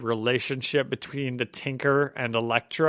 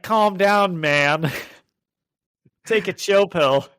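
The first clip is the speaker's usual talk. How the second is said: lightly voiced seventies dude, raised; chill-out dude, a little higher; seventies guy; 5 semitones higher, 4 dB louder, slower than usual. A hint of a seventies dude calming the goblin. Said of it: "He's like a seventies guy".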